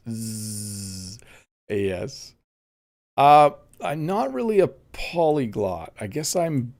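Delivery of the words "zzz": imitated buzzing. Reading a viewer's joke about his French, imitated buzz. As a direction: hold a soft, steady buzz. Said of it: "this is what I hear when Bob speaks French".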